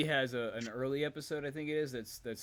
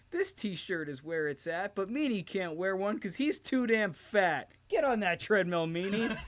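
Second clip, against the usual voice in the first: hyped rhyme; 8 semitones higher, 6 dB louder than usual. Retold or imitated. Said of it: "Stevie's like".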